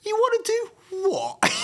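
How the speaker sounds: high, slow disbelieving "You wanna do what?"; incredulous what. Retold or imitated. Stranger on the street stunned by a prayer offer, incredulous. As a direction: play the baffled stranger, pitch shooting up.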